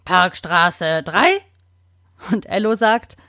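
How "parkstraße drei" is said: slow, lightly croaked rising address; croaking parrot. Acted. Budgie croaks from the apple tree, slow light rasp. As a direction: budgie rasping its address as a question. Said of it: "Bobby krächzt".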